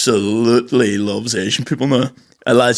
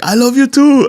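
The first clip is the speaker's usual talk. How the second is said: high, sing-out mock-loving reply; 13 semitones higher, 4 dB louder than usual. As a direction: coo it high, as a caricature. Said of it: "he kept being like".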